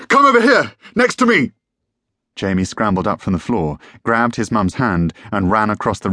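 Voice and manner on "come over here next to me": high, loud urgent command; bellow. A narrator's reading. Father calling family to his side, urgent bellow. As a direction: bellow to pull them close. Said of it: "bellowed Julian again".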